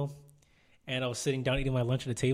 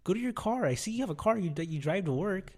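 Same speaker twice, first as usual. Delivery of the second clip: high-pitched mock scolding; exasperated telling-off; 7 semitones higher than usual. Telling a coworker off at lunch, high exasperated voice. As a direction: scold him in a raised pitch.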